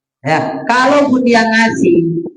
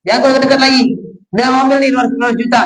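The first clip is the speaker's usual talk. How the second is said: higher, pushing a beggar away with scorn; mock scornful rebuff; 6 semitones higher than usual. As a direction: shoo him off while handing the money. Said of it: "misalnya gitu".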